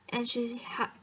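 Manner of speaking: plain reading voice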